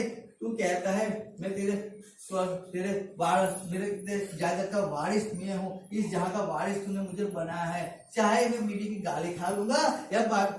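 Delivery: low, soft personal prayer; humble appeal to God. Acted. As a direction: speak softly and intimately to God. Voices a believer speaking to God. (धीमी, नीची आवाज़ में विनम्र प्रार्थना)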